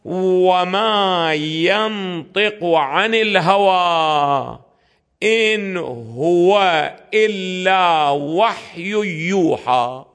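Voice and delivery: very slow, drawn-out Quranic chant of the verse; Quran recitation. Acted. Lecturer quotes the verse to his students, slow reverent recitation. قال تعالى.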